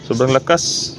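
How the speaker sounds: usual speaking voice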